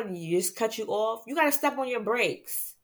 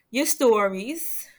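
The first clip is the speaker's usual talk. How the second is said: usual speaking voice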